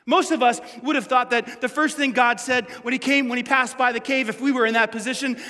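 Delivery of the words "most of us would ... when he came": normal talking voice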